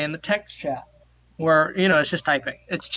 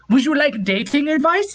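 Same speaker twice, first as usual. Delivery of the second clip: higher, louder put-on Norwegian accent; mock Norwegian accent; 9 semitones higher, 4 dB louder than usual. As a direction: ask eagerly as the Norwegian woman. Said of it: "this accent is".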